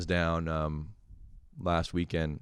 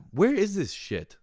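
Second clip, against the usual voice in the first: higher and louder, calling out into the room; a mock search yell for the lost hat; louder asking; 5 dB louder than usual. Shown as a demonstration. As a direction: call it out to nobody, louder. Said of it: "Like that".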